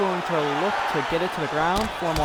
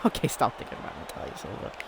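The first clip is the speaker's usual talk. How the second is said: whispering; 6 semitones lower, 8 dB quieter, faster than usual. Real, not acted. Whispered to himself.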